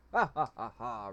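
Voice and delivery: loud spelled-out "ha ha ha"; story villain's gloating laugh, played loud; acted hearty laugh. A narrator's reading. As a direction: burst into a big stagey laugh. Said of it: "Raka laughs".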